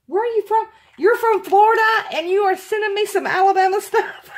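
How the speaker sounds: very high, excited disbelief; astonished delight. Real, not acted. Alabama fan astonished at a Floridian's gift, thrilled. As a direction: squeal the question in happy disbelief.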